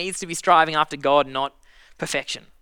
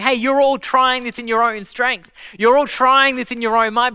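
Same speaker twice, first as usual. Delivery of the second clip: Jesus calling out; 5 semitones higher, 5 dB louder than usual. Acted. Jesus voiced rebuking effort.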